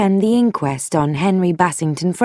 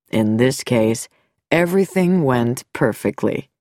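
lower, smooth-spoken doctor voice; a calm doctor hiding something; sinister doctor; 6 semitones lower than usual. Acted. Dr Nicholson voiced.

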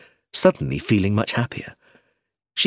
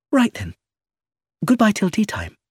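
put-on lighter, higher young woman, quick; higher voice for Iris, asking to leave; young woman's voice; 11 semitones higher than usual. A narrator's reading. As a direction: Iris asking to slip away.

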